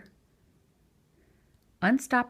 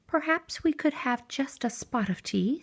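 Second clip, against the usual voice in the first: lightly raised, polite mouse voice; a small mouse asking nicely, a little higher; 6 semitones higher than usual. A narrator's reading. Mouse asking Bear for tea, lightly higher polite voice.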